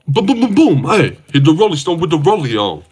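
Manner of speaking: loud, high booms into a chant; LeBron. Acted. Streamer recreating a great LeBron moment, loud hyped imitation. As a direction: imitate a hyped-up basketball star. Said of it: "that is a great LeBron moment".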